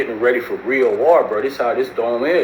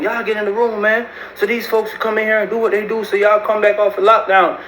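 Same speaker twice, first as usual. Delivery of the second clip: high-pitched mock officer calling out; imitated officer's order; 10 semitones higher than usual. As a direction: order everyone inside, voice pitched way up. Retold officer ordering inmates into rooms.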